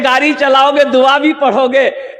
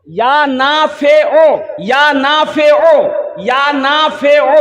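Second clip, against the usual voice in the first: stretched-out syllables for repeating; emphatic slow dictation; 3 semitones higher, slower than usual. Shown as a demonstration. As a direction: lead the crowd slowly. Leading the crowd in repeating it. (ज़ोर देकर, धीरे-धीरे दोहराते हुए)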